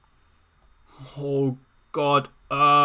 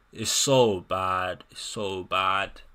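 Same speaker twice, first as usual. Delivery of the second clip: very slow, lower and softer; slow low moan; 9 semitones lower, 8 dB quieter, much slower than usual. Real, not acted. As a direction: groan it out in dismay. Moaning about Burnley's attack.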